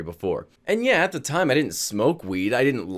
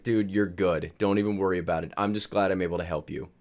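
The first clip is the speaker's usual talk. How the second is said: lower, softer easy reassurance; calm a worried dad, understated; reassuring son; 6 semitones lower, 5 dB quieter than usual. Retold or imitated.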